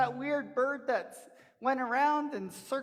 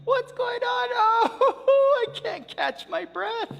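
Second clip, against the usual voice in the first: much higher, loud, slow panicked cry; breathless panic; 9 dB louder, slower than usual. Acted. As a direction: wail it out, gasping for air. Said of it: "And he was like".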